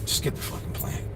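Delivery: whispered under the breath, giving up; exasperated whisper. Retold or imitated. Boyfriend muttering about wife's plant, whispered. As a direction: hiss it to yourself, fed up. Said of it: "you would hear how this plant".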